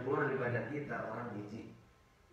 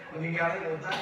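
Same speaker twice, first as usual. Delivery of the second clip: louder, laughing as he says it; laughing punchline; 5 semitones higher, 7 dB louder than usual. Retold or imitated. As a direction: deliver the joke through laughter. (menyampaikan lelucon sambil tertawa)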